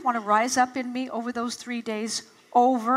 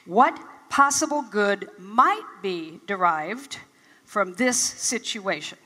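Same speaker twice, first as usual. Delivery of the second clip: slow, weighty question; 2 semitones lower, slower than usual. Retold or imitated. Minister's question, repeated slowly.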